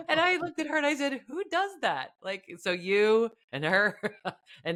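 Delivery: high, laughing disbelief. Real, not acted. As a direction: say it through laughter, amazed. Laughing at broken card machine.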